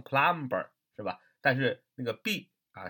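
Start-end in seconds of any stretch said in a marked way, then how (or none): 0.0-0.7 "plumber" with its silent b sounded; mispronounced plumber. Shown as a demonstration. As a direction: say "plumber" as plum-ber, b and all. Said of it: "很容易读成". (照拼写把 plumber 的 b 读出来)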